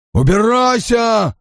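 high, drawn-out yell; repeated angry shout. A narrator's reading. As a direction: shout it long and high to drive her off. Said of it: "выкрикнул он снова". (протяжный злой крик)

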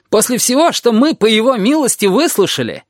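high-pitched aggrieved outburst; indignant huffing. A narrator's reading. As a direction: protest as the wronged party, falling at the end. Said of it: "фыркнула душенька". (возмущённо, с обидой)

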